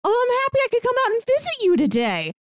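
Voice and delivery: normal talking voice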